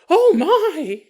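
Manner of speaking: high, loud "oh" of alarm; mock startled cry. Startled dying woman in bed.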